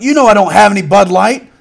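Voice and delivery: loud, high yelled reply; shouted retort. Retold or imitated. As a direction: fire it back across the aisle. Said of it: "the other guy's like".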